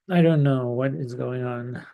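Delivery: ordinary talk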